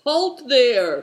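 high, loud cry; cried out. A narrator's reading. Good Fortune jumping up to stop the old man, loud cry. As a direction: stop them with a shout. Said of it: "cried Good Fortune".

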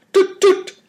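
high piercing toot toot like a car horn. Acted. Driver sounding the horn to the boys, high tooting. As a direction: squeeze out a horn's toot. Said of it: "Good Fortune sounded the horn".

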